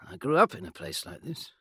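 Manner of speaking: plain reading voice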